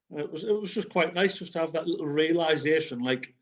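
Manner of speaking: ordinary talk, nothing marked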